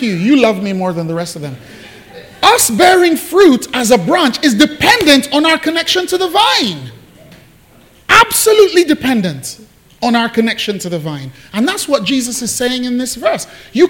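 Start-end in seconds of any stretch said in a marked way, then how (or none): 2.4-11.3 high, loud preacher's peak; preaching at full force. Acted. Preacher pressing the point on his flock, fervent. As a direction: drive the point home, much higher and louder.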